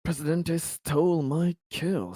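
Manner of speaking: ordinary talk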